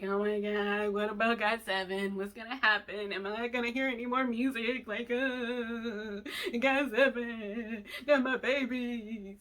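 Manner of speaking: hammed-up worried wail, dragging out the last words; mock despair over the band, slowing at the end; dramatic fan worry. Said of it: "I'm being dramatic".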